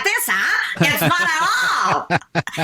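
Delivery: high, exaggerated French accent. Shown as a demonstration. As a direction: ham up a French accent, drawing out the end. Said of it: "all French accents were Parisian".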